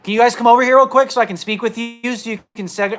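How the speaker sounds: calling through the door. Retold or imitated. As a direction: call out to the guards. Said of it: "knock on the damn door and say".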